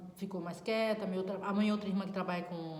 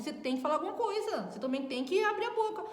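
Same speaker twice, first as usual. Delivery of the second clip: sister's pushy demand; 9 semitones higher than usual. Retold or imitated. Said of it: "Ela falou assim".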